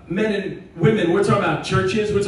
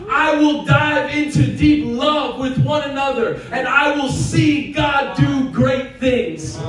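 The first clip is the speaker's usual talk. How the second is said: slow, higher, emphatic declaration; preaching declaration; 5 semitones higher, slower than usual. Acted. Pastor leading the church in a vow, slow and emphatic.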